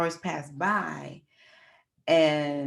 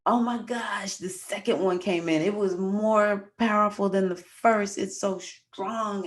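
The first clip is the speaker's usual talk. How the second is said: higher pained voice, slowing at the end; mock contraction, higher, slowing down; retold labor pain; 4 semitones higher than usual. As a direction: drag out the last words as the contraction peaks. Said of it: "And I'm like".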